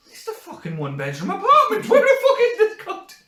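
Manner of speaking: high, loud outraged panic with laughter; mock panic. Living alone and finding the toilet seat warm, panicked shout. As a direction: shriek it as if an intruder is hiding.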